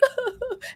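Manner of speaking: soft, high imitated laugh-like noise; imitated character sound. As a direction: mimic her high, quiet outburst. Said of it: "She's like".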